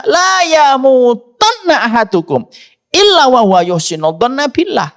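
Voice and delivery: high-pitched, driving sermon voice; impassioned preaching. Real, not acted. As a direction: preach it insistently, pitched well up. (berkhotbah dengan nada tinggi dan berapi-api)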